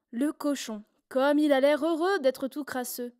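usual speaking voice

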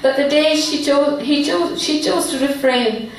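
measured phrases, each image set apart; heartfelt poem recitation. Acted. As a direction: recite your poem solemnly, with feeling. Resumes her poem.

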